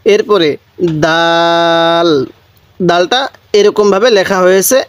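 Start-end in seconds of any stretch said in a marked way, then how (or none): 1.0-2.1 দাল said slowly with a firm heavy d; hard dal. Shown as a demonstration. Teacher shows the hard dal to learners, slow and firm. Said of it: "শক্ত করে উচ্চারণ করব".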